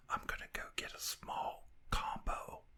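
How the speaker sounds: imitated theater whisper; very soft, hushed retold whisper. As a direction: whisper it like a secret aside in a cinema. Wife leans over during trailers.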